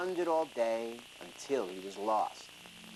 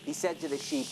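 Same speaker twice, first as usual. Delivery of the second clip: ordinary delivery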